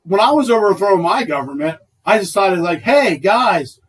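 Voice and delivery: loud, high mock rioter calling it quits. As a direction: ham up a rioter heading home for curfew.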